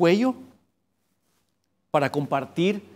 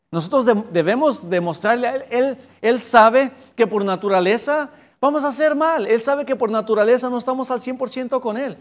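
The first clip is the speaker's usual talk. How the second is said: raised, louder preaching; fervent preaching; 8 semitones higher, 4 dB louder than usual. Acted. Preacher pressing the congregation, raised and louder. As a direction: drive the point home to the congregation.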